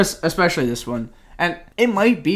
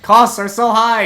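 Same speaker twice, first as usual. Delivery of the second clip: imitating a panicked high, loud complaint; mock panic, pitched up and loud; freaking out; 10 semitones higher, 8 dB louder than usual. Mary fretting over construction costs, freaking out. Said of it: "Mary just freaking out".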